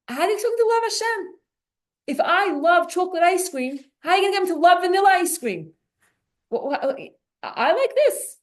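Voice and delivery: high, fast, excited; mock a stubborn listener's objection; rapid high-pitched rhetorical complaint; animated mock protest. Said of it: "playful mock of listener's voice".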